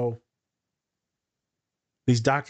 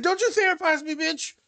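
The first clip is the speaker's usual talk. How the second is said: high, sassy mock-offended snap; to the therapist, in a high sassy voice; sassy retort. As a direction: fire back with diva attitude.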